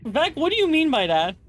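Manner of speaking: high confused what; startled asking what he meant; surprised. Real, not acted. Confused by odd quote.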